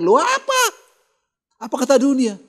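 loud, high-pitched mock disbelief; mock scandalised outcry. Preacher mimicking reproach of crying servants, scandalised outcry. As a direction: exclaim it as an imitated rebuke.

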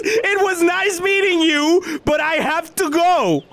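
high, slow dramatic movie farewell; movie farewell line. Acted. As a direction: say it like a film heroine leaving. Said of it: "I've seen this in movies".

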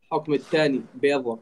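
ordinary delivery

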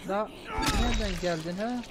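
high, loud scolding yell; angry shout. Real, not acted. Gamer yelling at an enemy to get lost. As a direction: drive it off with a yell.

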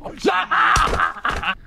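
very high-pitched cry of fright; startled shriek. Real, not acted. Player caught mid-hiding in a horror game, startled shriek. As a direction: shriek as if jumped.

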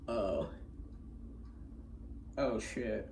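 low, hushed oh-no; mock quiet dismay; caught-out dread. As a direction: say it small and low, as if busted. Caught as mom pulls up.

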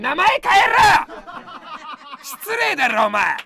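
high, loud shout breaking into laughter; laughing shout. Real, not acted. Streamer yelling at a superchatter named after a celebrity, half laughing. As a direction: yell it, cracking up.

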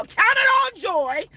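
normal talking voice

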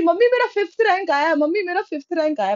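high, gleeful call from far off; joyful child calling to mom across the street; excited calling out. Retold or imitated. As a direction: shout the news home like a proud kid. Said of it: "माँ को दूर से चिल्ला रही".

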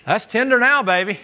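loud, high, swaggering brag to the steak; cocky pleased boast. Pretend. Preacher showing off his pounded steak, loud cocky brag. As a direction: crow over the flattened steak like a proud cook.

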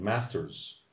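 Masters with a clear buzzing z at the end; final z sound. Shown as a demonstration. Teacher shows the student the final z. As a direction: land the z on Masters. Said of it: "you're gonna say Z at the end".

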